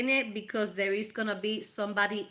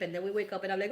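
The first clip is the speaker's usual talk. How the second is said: ordinary delivery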